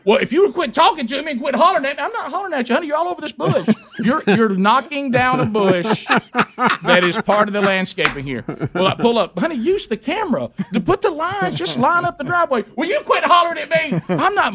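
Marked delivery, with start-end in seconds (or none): 0.0-2.0 high mock wife snapping back; wife's retort. Wife to husband, high annoyed retort. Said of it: "she's like". 2.0-8.4 high, laughing i'm not hollering; laughing protest. Retold or imitated. Husband to wife about the bush, laughing protest. 9.4-14.5 high, pleading honey voice; retold quarrel. Husband and wife bickering over parking, high voices.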